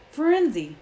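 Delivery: loud, high cry of outrage; thundering. A narrator's reading. Bane confronting Firenze in the clearing, thundering. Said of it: "Bane thundered".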